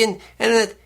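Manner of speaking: ordinary talk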